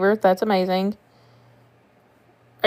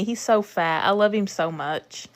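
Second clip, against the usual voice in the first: ordinary talk, nothing marked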